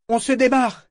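very high, loud call; shouted order. A narrator's reading. Yémin to Yola once all are aboard, shouting. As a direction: shout it high across the deck. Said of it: "cria-t-il à Yola".

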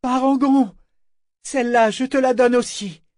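high, loud, ringing shout; resounding call. A narrator's reading. Ship Vivacia hailing Parangon across water, ringing shout. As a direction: send it ringing out over the sea. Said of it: "Sa voix sonore retentit au-dessus de la mer".

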